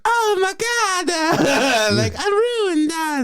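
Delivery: very high, hammy Italian wail; overacted fake-Italian despair; Jared Leto. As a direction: lament in a mock Italian squeal. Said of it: "there comes Jared Leto".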